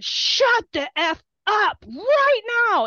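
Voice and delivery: high, slow, emphatic yell; re-enacted indignant shout; retold angry outburst. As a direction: tell them off, drawing out every word. Retold outburst at hecklers in stands.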